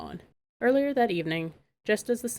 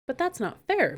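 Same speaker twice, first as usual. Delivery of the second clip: higher, louder protest; cried out; 7 semitones higher, 3 dB louder than usual. A narrator's reading. Megan protesting to the queen, cried out. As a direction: cry out that it isn't fair. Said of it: "Megan cried".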